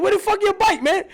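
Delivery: angry yell. Retold or imitated. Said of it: "I'm like".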